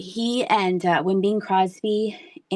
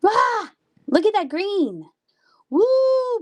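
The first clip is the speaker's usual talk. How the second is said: much higher and louder, thrilled; delighted squeal; 13 semitones higher, 4 dB louder, slower than usual. Real, not acted. Streamer shows viewers the glowing cat, squealing with delight.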